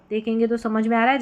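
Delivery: ordinary delivery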